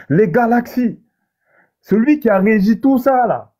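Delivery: preacher lifting the audience in awe; awed preaching. Acted. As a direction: preach the vastness of creation, building. Preaches about God's immensity.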